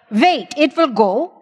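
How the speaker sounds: stern German. Retold or imitated. Tough German Mennonite family voice.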